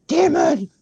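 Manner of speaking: mock-dramatic banishing of a demon; cheesy psychic. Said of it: "The guy walks in playing the psychic going".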